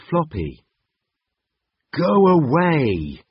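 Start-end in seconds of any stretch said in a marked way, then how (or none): 1.9-3.2 slow, loud dragon roar. Acted. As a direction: roar it like an angry mother dragon. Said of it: "she roared".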